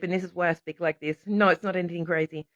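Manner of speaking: ordinary delivery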